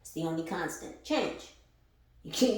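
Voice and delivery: ordinary talk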